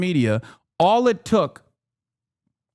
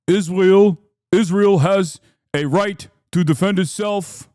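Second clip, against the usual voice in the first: Philadelphia-tinged parody voice; mock Middle Eastern accent that sounds like Philadelphia; 3 semitones higher, 3 dB louder than usual. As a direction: say it like a Philly guy. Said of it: "sounds quite similar to the Philadelphia accent".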